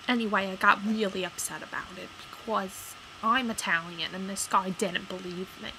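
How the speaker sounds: ordinary talk, nothing marked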